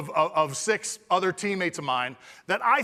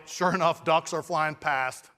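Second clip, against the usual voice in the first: normal talking voice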